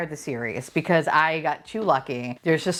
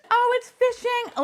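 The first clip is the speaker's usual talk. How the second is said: laughing shriek. Real, not acted. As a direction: shriek through laughter at the wrong pull. Wrong figure, laughing outburst.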